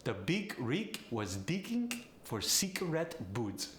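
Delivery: Polish accent with G hardened to K. Shown as a demonstration. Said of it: "The G goes to a K".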